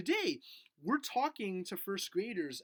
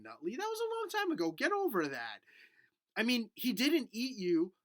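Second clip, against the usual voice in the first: usual speaking voice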